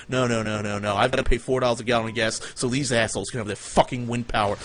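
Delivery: normal talking voice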